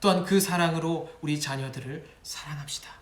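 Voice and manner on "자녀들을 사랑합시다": whisper. Acted. Sermon line suddenly whispered.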